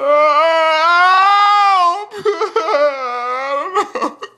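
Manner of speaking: loud, high, crying laughter; howling laugh. Pretend. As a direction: cackle with mock despair. Mock panic dissolves into laughter.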